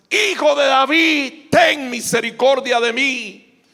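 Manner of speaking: loud, high, drawn-out shout; crying out harder. Acted. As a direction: cry out insistently, stretching each word. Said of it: "él clamaba mucho más".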